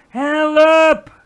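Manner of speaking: very loud, high, held cry for help; screech. A narrator's reading. Giant screams for help in his nightmare, high loud screech. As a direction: screech the word at full power. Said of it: "krijste de vleeslapeter".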